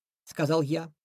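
usual speaking voice

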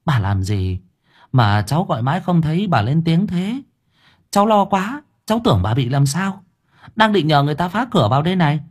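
put-on high-pitched anxious young neighbour; hurried, worried. A narrator's reading. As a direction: fret at her in a rush of relief and worry. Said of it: "Bà Ngân vội vã". (giọng vội vã, lo lắng)

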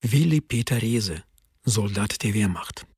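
usual speaking voice

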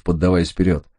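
ordinary delivery

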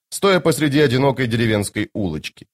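ordinary delivery, nothing marked

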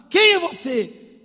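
high, loud, drawn-out challenge; emphatic question. Retold or imitated. Preacher puts the basic question, shouted slowly. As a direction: throw the question out at full force.

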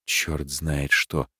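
ordinary delivery, nothing marked

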